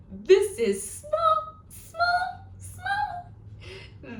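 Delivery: tiny high voice for small. Shown as a demonstration. Librarian showing small to children, tiny squeak. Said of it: "Should we try that one one more time".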